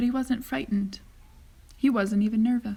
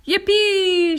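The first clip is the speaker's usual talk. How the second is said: loud, high whoop; an old man thrilled by the rocket ride; whooping with glee; joyful shout; 10 semitones higher, 12 dB louder than usual. A narrator's reading. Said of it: "shouted Grandpa Joe".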